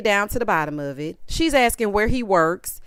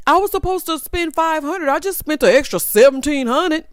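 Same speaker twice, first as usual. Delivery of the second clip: lightly mimicked man, higher pitched; higher mock voice owning up to overspending; mock excuse-making; 5 semitones higher, 3 dB louder than usual. Imitating a man excusing his spending to Kim, lightly mocking. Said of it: "you standing here scolding him because he done spent money".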